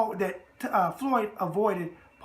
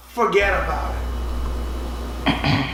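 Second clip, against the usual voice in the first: New York catchphrase; 3 semitones lower, 6 dB louder, much slower than usual. Acted. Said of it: "and the New York thing".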